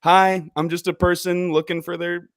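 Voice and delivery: high, loud breezy customer voice; mock casual customer. Customer honestly reaching out to a business, high and breezy. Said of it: "authentically reach out ... and be like".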